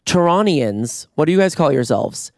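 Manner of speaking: normal talking voice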